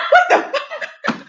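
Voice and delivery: very high, loud, drawn-out curse; comic high-pitched disbelief; retold outraged shock. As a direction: squeal it slowly through laughter. Retells shock, laughing.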